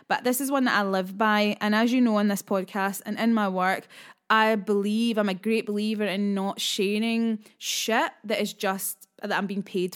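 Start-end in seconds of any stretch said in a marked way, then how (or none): none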